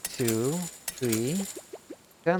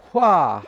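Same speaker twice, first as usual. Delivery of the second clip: much louder wah of alarm; sudden fright cry; loud startled yelp; 8 dB louder than usual. Real, not acted. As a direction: yelp as if knocked back. Eruption hits.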